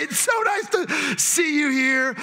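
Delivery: high, drawn-out mock niceness; mock gushing greeting. Newcomer mimicking an unwanted greeter, mock gushing. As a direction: overdo the friendly greeting, slow and high. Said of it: "I don't want somebody to say".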